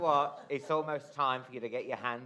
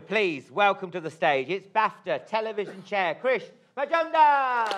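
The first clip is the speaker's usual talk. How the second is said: stage announcer introduction; 6 semitones higher, 5 dB louder than usual. Real, not acted. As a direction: announce the guest to the hall, stretching the name loud and high. Host announces presenter onto stage.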